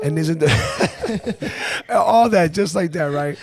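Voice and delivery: laughing through the words; words broken up by laughs. Real, not acted. Laughing while retelling the texts.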